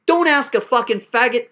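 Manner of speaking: ordinary talk, nothing marked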